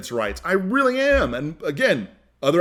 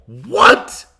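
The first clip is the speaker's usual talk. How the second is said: outraged what; 6 semitones higher, 7 dB louder than usual. Real, not acted. Outraged disbelief at the dismissal.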